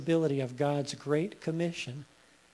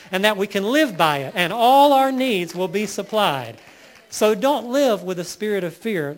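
louder, higher preacher's delivery with punched key words; impassioned preaching; 4 semitones higher, 7 dB louder than usual. Real, not acted. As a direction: preach it out with building force and conviction. Preaches at rising full force.

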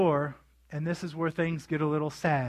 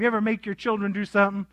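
usual speaking voice

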